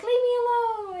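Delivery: very high, singsong whine; hammed-up high plea; mock dramatic protest. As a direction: replay your own protest, high and drawn. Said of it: "And I'm like".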